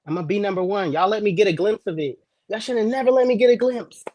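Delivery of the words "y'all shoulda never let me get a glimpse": higher, louder boast; hyped brag shout. Real, not acted. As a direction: pump it up, crowing. Hyping himself up.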